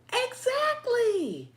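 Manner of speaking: high, long drawn-out exclamation; emphatic agreement. Real, not acted. Host agreeing with viewer about store produce, emphatic. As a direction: sing out the word, stretched wide.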